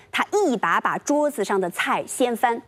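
ordinary talk

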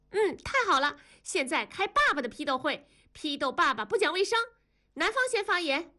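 put-on high-pitched gleeful little girl; gleeful child. A narrator's reading. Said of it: "燕妮就笑了". (兴奋得意的小女孩高音)

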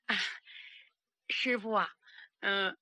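ordinary delivery

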